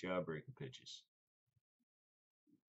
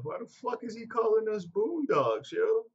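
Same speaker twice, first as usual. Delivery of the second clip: high, loud mock outrage, drawn out at the end; incredulous falsetto rant; baffled inner voice; 10 dB louder than usual. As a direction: play the bewildered thought big and squeaky. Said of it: "I was sitting here thinking".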